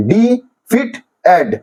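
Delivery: slow defeated with a pause after each syllable. Shown as a demonstration. Teacher repeating the division for students, defeated slowly syllable by syllable. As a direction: separate defeated into ডি, ফিট, এড. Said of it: "syllabic division করা হয়েছে".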